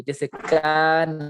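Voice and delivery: कान slowly, with thin light aa; light kaan. Shown as a demonstration. Teacher models the light alif in कान, slow. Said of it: "अलिफ हम बारीक पढ़ेंगे".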